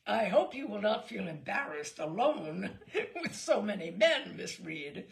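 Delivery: loud hearty laughing captain; booming with a laugh at the start. A narrator's reading. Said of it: "said the captain heartily".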